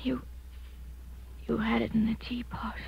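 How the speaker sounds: weak, breathy whisper as she fades; faint whisper. Acted. Drugged woman accusing her aunt as she collapses, faint whisper. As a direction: barely get the words out.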